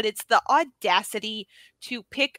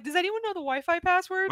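airheaded question in a high voice; Kara voice; 8 semitones higher than usual. Retold or imitated. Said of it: "Kara who's like".

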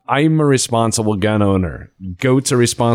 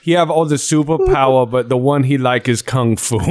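mock broken English; 4 semitones higher than usual. Mock broken-English narration, laughing.

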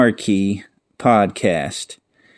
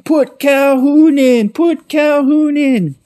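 high, loud, drawn-out yell; parking-lot fans yelling; 4 dB louder, slower than usual. Retold or imitated. People in the parking lot join in, loud high drawn-out yelling. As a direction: holler it like fans outside the stadium. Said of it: "people in the parking lot start doing it".

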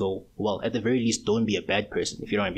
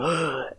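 mock gasp; 7 semitones higher than usual. Mock reaction to manly brother.

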